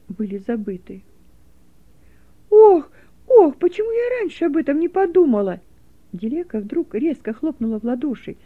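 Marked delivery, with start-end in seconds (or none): 2.5-5.6 high wailing cry, much louder. Acted. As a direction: cry out in tearful dismay. Girl suddenly realises, cries out.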